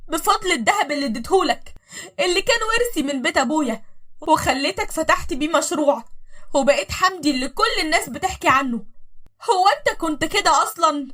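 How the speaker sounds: high, loud pleading accusation; bitter reproach. Acted. Wife reminding husband her gold built him. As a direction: throw his past back at him.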